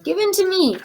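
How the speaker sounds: loud, high desperate plea; desperate demand. Acted. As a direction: reach for him, pleading. Mother screaming.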